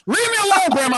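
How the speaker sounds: high loud yell; exasperated yell. Retold or imitated. Grandson fed up with Zoom help yells at grandma. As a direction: snap at her to leave you alone.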